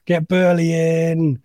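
slow, drawn-out mock West Midlands accent; a jokey West Midlands impression of a fan. Host doing a caller's manager pick in a mock West Midlands accent. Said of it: "It was an offensive West Midlands accent".

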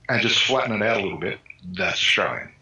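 ordinary delivery

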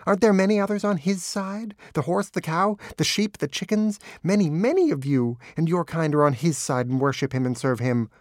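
high, impassioned tirade; worked-up, zealous dog voice; frenzied devotion. A narrator's reading. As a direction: cry it out fervently, high-pitched. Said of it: "a frenzy of devotion".

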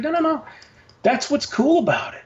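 high-pitched, insistent pushback at normal volume; retold protest. Acted protest against being scolded.

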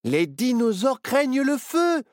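high, lit up with an idea; sudden inspiration. A narrator's reading. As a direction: announce a brainwave excitedly. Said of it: "s'illumina Nao". (illumination soudaine, voix aiguë)

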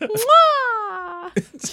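loud high air kiss; kiss sound. Real, not acted. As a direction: blow a big kiss. Kiss sent to parrot.

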